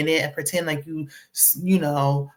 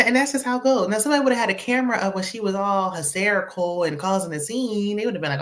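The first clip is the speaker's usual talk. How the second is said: ordinary talk, nothing marked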